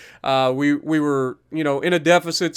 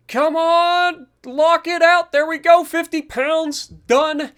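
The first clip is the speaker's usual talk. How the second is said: strained lift cheer; 9 semitones higher, slower than usual. Real, not acted. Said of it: "We're struggling".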